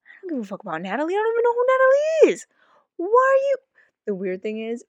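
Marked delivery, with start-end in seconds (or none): 0.0-3.5 fast then shouted high; exasperated shout. Retold or imitated. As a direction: rant it, rising to a yell.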